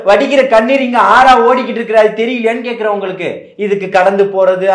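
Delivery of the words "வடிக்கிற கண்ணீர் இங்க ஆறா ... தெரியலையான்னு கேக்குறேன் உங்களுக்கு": raised, higher-pitched scornful retort; heated accusation. Real, not acted. Party speaker taunts opponents over their crying, heated. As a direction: cut in loudly and accuse.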